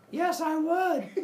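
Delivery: a hint of a goofy monster; eager Cyclops. Cyclops answering Zeus.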